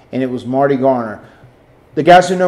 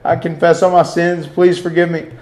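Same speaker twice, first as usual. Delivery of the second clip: raised, pleading mock prayer; higher-pitched, hammed-up begging for forgiveness; desperate praying; 3 semitones higher than usual. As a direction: imitate a tearful plea to God. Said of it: "And he started praying".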